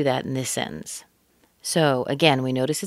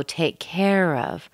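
slow phrase with CARE drawn out; stress on CARE; slower than usual. Shown as a demonstration. Teacher demonstrates more stress on care, drawing CARE out. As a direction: give CARE more weight in "take care of". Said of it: "a little bit more stress on care".

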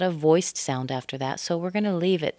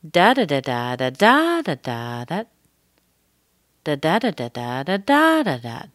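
sing-song "da" pattern, stressed "da" jumps up; intonation on da-da's; 4 dB louder than usual. Shown as a demonstration. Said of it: "do the whole thing together with da, da's".

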